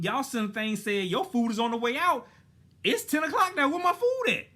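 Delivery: high, loud indignant rant; rising outrage; heated rant. Real, not acted. Storyteller reliving the insult, loud heated rant. Venting at being told to calm.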